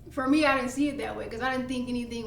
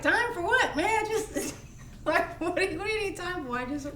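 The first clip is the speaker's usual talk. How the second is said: high, laughing incredulous retort; can't believe what he hears; baffled; 7 semitones higher than usual. Retold or imitated. To partner needing time, laughing baffled high retort.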